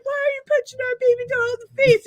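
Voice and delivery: high, loud mock child protest; upset little girls. Little girls confronting a man over their doll, mock upset. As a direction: cry out as pretend outraged little girls. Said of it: "and they're like".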